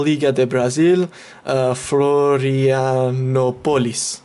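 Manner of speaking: put-on slow, drawn-out go at a Brazilian accent; attempted Brazilian accent. Gamer reading out the Brazilian league and team, in an attempted Brazilian accent. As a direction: put on your best Brazilian accent, stretching the syllables. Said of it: "that's my best accent I can put on".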